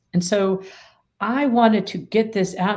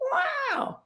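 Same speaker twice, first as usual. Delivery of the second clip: a squeaky, much higher "Wow"; mock amygdala wow; 5 dB quieter than usual. Amygdala reacting to being told to calm down, squeaky. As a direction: yelp it like an alarmed brain. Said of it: "the amygdala is go".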